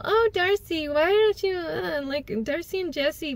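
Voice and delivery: high mocking voice imitating Rachel. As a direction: mimic her in a high, snide voice. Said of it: "Rachel's all".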